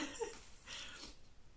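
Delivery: breathy embarrassed laugh; soft laugh. Real, not acted. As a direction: laugh at yourself quietly. Laughs about crying again.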